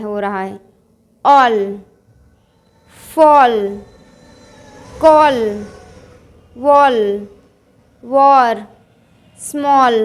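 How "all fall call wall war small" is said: "all, fall, call, wall, war, small" with a rounded aw; open aw vowel. Shown as a demonstration. Teacher drilling the aw sound for learners, "all, fall, call, wall, war, small" with open aw. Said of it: "अ का उच्चारण हो रहा है".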